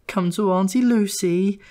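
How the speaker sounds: high, cooing auntie voice; cooing Lucy. A narrator's reading. Lucy coos to the little brother, high and sugary. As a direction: coo it out sweetly and sickly. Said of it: "she'd coo".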